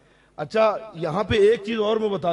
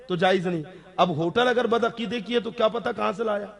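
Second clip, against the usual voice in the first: normal talking voice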